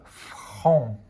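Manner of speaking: "franc" drawn out on its own; isolated syllable. Shown as a demonstration. Teacher giving the answer to pupils, syllable "franc" stretched out. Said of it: "on entend la syllabe".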